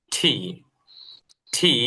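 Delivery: each "টি" separate, opening on Bengali ঠ; T aspirated. Shown as a demonstration. Teacher modelling for the class, "টি" as aspirated "ṭhi". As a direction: say "টি" as long "ṭhi", with a puff of air. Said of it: "মানে ঠয় দীর্ঘিকারে".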